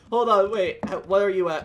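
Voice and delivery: usual speaking voice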